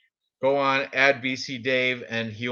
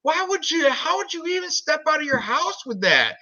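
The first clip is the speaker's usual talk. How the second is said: high squeaky disbelief, much higher; shocked disbelief. Retold or imitated. Telling a friend about spider webs, high shocked squeal. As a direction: recoil at the sight in mock horror. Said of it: "I'm like".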